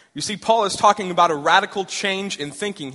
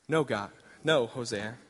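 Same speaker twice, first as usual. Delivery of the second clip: lower, softer, calm divine voice; voice of God; 6 semitones lower, 6 dB quieter than usual. Acted. God answering eager Hosea, low and calm. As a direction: speak as God, low and steady.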